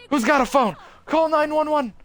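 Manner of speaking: high, loud panicked yelling; panicked shout. Acted. Student screams at the crowd to call for help, panicked.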